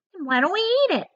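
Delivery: put-on high, mean old aunt; the greedy aunt, pitched high; aunt's voice. Aunt Spiker eyeing the peach, high and greedy. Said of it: "Aunt Sponge suggested".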